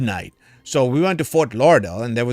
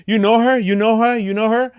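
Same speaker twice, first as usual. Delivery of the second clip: nephews pestering him, stunned: you know her?; amazed nephews; 9 semitones higher than usual. Retold or imitated. Two nephews at the bar asking him, amazed and higher.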